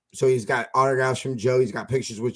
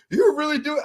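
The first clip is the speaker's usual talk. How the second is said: much higher, disbelieving; high amazed boss; 14 semitones higher than usual. Retold or imitated. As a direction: squeal of disbelief. Boss surprised.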